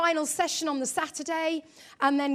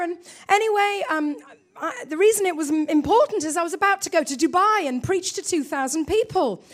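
ordinary talk, nothing marked

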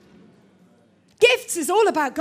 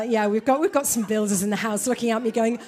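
normal talking voice

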